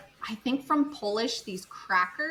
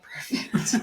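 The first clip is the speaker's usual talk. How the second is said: breathless laughing whisper of perfect. Retold or imitated. Approving the romance book club, whispering Perfect while laughing. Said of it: "I'm like".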